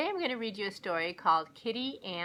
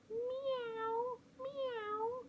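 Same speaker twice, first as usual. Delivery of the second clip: much higher, mewing cat voice; kitty meowing; 6 dB quieter than usual. Acted. Hungry kitty meowing at the door, high and mewing. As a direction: mew like a small cat.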